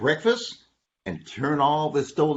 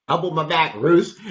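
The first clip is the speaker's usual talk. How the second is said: ordinary delivery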